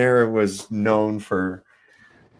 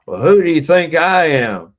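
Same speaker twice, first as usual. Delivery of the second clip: higher, louder drill sergeant challenge; drill sergeant bark; 6 semitones higher, 10 dB louder than usual. Retold or imitated. Old-timer sergeant barking at a fresh private, drill sergeant style. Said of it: "he talked like a fucking drill sergeant".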